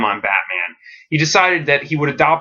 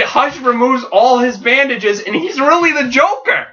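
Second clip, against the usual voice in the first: pitched way up, selling the twist; excited reveal; 11 semitones higher than usual. Acted. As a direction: announce the shock like a trailer.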